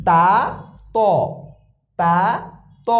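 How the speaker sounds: light, thin তা against heavy, rounded ত; thin-thick contrast. Shown as a demonstration. Teacher drilling two confused letters, thin তা then thick ত. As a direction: alternate open thin তা with round-mouthed thick ত. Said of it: "ত আর তার ভিতরে উচ্চারণে পার্থক্য আছে".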